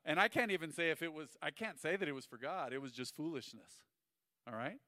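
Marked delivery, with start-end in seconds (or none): none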